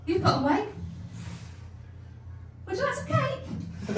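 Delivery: a sweet little gran offering cake; nan's voice. Retold or imitated. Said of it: "she went".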